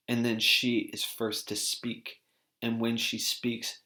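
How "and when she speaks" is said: ordinary delivery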